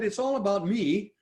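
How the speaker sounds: lightly voiced self-centered person, mild smug tone; a hint of a smug me-first voice; mild mock of the selfish camp. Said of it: "the people who said".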